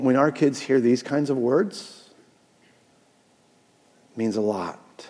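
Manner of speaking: ordinary talk, nothing marked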